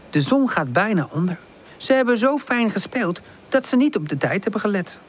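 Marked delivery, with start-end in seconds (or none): none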